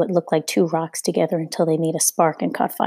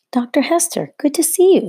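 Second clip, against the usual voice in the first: cheerful greeting; 10 semitones higher, 4 dB louder than usual. A narrator's reading. Roamer greets doctor warmly.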